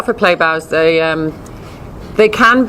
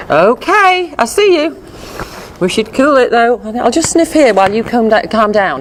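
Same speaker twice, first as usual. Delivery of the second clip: higher, gentle dog placating; soothing dog voice; 6 semitones higher than usual. Retold or imitated. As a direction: talk the owner down as the dog. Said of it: "calming signals".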